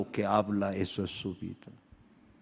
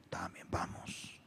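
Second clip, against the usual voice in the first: whisper; 6 semitones lower, 20 dB quieter, slower than usual. Retold or imitated. Child begging father.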